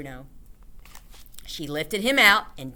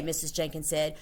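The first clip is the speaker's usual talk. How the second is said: usual speaking voice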